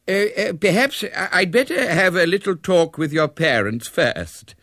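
put-on high, hesitant old man; stammer, unsure how to proceed; uncertain. A narrator's reading. Nonplussed coach fears frightening the child, uncertain. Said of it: "he said uncertainly".

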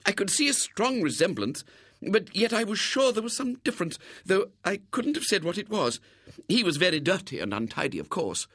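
high-pitched, friendly lady's voice; mollified lady. A narrator's reading. Lady explains how the twins differ, mollified. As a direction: voice a convinced lady, well up in pitch. Lady talks to supposed twin.

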